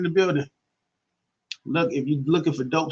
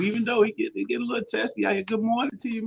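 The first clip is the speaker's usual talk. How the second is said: ordinary delivery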